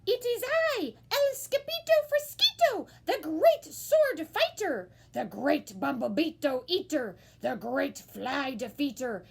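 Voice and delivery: high, loud grand boast; a high kitten hero boasting; heroic boast. A narrator's reading. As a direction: announce himself like a great swordsman. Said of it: "said Scipito".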